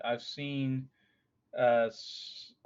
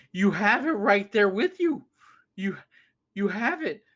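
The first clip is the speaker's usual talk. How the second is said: exasperated; 7 semitones higher, 6 dB louder than usual. Retold or imitated. Arguing with mother on phone.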